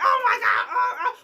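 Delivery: very high, loud, laughing shouts; losing our minds. Retold or imitated. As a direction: lose it together in shocked delight. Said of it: "We were losing our minds".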